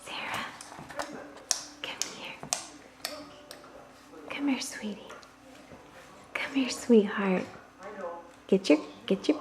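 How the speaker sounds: soft whispered coaxing; whispering. Real, not acted. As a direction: whisper her over gently. Whispered calls to baby.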